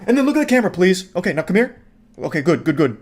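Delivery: easing down from a yell into lightly acted coaxing; mock director mom. Mom posing her son for the camera after yelling, lightly acted coaxing. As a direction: drop the rage, direct the shot.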